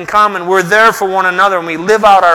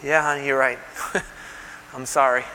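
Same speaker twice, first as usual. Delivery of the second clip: quiet, gentle giving-in; soft apology to wife; 6 semitones lower, 11 dB quieter than usual. Retold or imitated. Husband softly apologizing to his wife, hushed and gentle. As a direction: softly concede to your wife. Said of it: "My wife the other day said".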